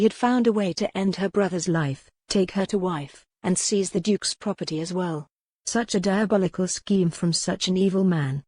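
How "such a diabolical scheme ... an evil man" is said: ordinary delivery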